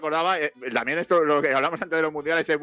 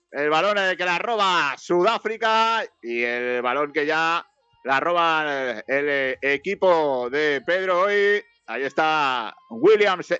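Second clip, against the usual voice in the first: slow, drawn-out football call; play-by-play commentary; 4 semitones higher, slower than usual. Real, not acted. Commentator tracks ball from Mexico to South Africa, play-by-play. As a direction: follow the ball aloud, stretching each name.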